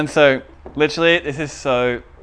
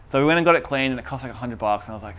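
ordinary talk, nothing marked

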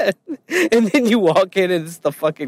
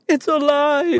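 mad scientist cry; 12 semitones higher than usual. Pretend. Frankenstein quote, shouted.

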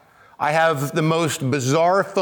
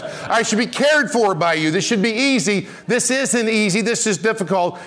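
higher, pleading complaint to God; retold frustrated prayer, voice pitched up; exasperated plea to God; 5 semitones higher than usual. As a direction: whine it up at heaven, half laughing.

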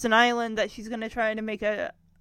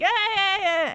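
calling for calm; 8 semitones higher, 6 dB louder, slower than usual. Retold or imitated. Calming a panicking group.

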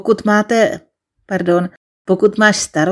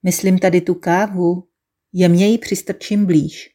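ordinary delivery

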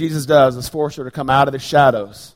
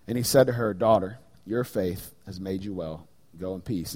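quieter, measured Bible reading; scripture reading; 6 semitones lower, 4 dB quieter than usual. Acted. Preacher reading Luke to his church, hushed and reverent. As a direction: read the passage softly and reverently to the congregation. Reads Luke passage aloud.

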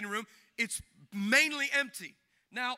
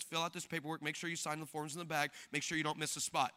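low, soft, can't-be-bothered rattling off of instructions; a receptionist reciting the rules without looking up; bored receptionist; 7 semitones lower, 8 dB quieter than usual. Retold or imitated. Indifferent receptionist, no eye contact.

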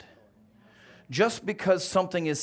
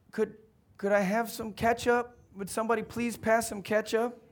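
put-on higher, meek child's request; timid little boy, pitched up; poor little boy; 7 semitones higher than usual. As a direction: plead politely in a small boy's voice. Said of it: "Poor little Timmy. He says".

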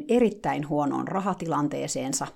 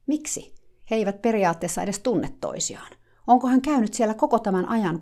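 ordinary delivery

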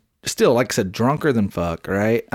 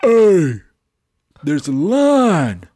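loud, firm call across the line; stretched-out loud warning; retold call-out; 4 semitones higher, 6 dB louder, much slower than usual. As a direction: call him out, loud and slow. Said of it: "I said".

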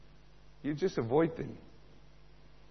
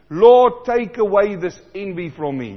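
loud cry of a prayer to the Lord; pleading prayer; 5 semitones higher, 11 dB louder than usual. Acted. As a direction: cry out to God for help. Preacher voicing a prayer.

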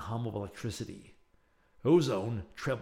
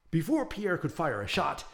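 ordinary delivery

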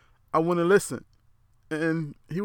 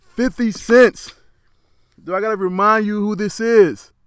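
loud emphatic; 6 semitones higher, 10 dB louder than usual. Real, not acted. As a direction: belt out the name proudly. Hyping up [name]'s reputation.

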